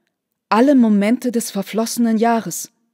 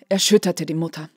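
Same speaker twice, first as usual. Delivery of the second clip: ordinary delivery, nothing marked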